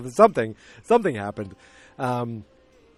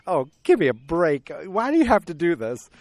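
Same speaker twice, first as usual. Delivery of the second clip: louder, rising exasperated protest; 4 semitones higher, 4 dB louder than usual. Retold or imitated. Viewer reacting to how it happened, exasperated. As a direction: complain at the movie in mock disbelief.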